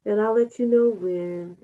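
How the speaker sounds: ordinary delivery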